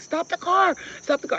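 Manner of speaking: high loud urgent yell. Retold or imitated. To the cab driver with bees in her shirt, urgent yell. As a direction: scream for the cab to stop. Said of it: "I'm like".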